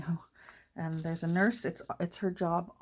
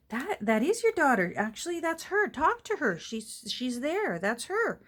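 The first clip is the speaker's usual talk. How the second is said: a lightly voiced nurse, higher and patiently insistent; nurse explaining; 7 semitones higher, 4 dB louder than usual. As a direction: coax the old lady gently but firmly. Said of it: "the nurse will have to say".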